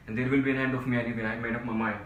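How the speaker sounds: loud, rapid, defiant threat; agitated outburst. Acted. Desperate man threatening his friend he will end it, loud and agitated. Threatens suicide after being shushed.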